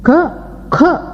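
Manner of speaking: first க as "ka", second as "kha"; k then aspirated k. Shown as a demonstration. Teacher contrasting letters, க as "ka" then "kha". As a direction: add a puff of air on the second க. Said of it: "இந்த நான்கு உச்சரிப்புகளை".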